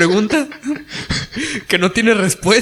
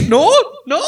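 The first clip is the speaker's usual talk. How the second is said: panicked refusal; 12 semitones higher than usual. Retold or imitated. Retold refusal to go on stage.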